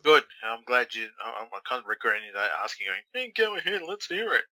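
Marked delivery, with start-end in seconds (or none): 3.1-4.4 higher, sing-song mock of his own eagerness; mocking himself. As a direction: parrot your earlier self mockingly. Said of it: "I told you that".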